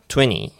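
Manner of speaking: "twenty" said as "tweny", no T burst; T dropped. Shown as a demonstration. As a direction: skip the T release in "twenty". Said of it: "もうTの破裂いらないんです". (Tの破裂なしの「twenty」)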